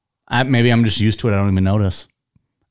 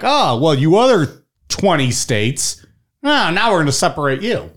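mock dismissive quote; 6 semitones higher than usual. Small states sorting the other twenty states, mock dismissive. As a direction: lightly act out the states brushing others aside. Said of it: "that they're like".

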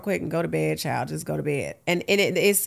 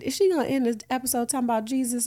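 mock listeners; 5 semitones higher, much faster than usual. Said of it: "They like".

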